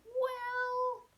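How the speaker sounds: long, high held "well"; reluctant hedging. Retold or imitated. Innkeepers asked for a room, hedging reluctantly. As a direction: stall on one high, stretched note.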